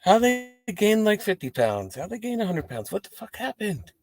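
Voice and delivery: high, disgusted gossip. Retold or imitated. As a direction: sneer at old classmates. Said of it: "you're just like".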